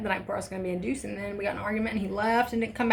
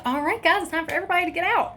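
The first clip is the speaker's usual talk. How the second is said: brisk nurse; 10 semitones higher, 4 dB louder, faster than usual. Retold or imitated. Nurse clearing the room.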